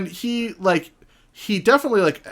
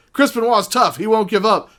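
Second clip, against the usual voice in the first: higher, louder mock announcer praise; mock commentator hype; 9 semitones higher, 5 dB louder than usual. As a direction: ham up the commentators' awe. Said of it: "people being like".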